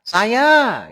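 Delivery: high, loud mocking "Saya"; mock the nameless reply; mock wrong answer. Preacher mocks the nameless reply, high and loud.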